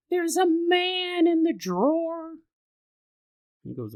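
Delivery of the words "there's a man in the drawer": put-on high, slow child's voice; a toddler tells of the man; slow, high, eerie child voice; creepy little girl. Toddler daughter voiced in story.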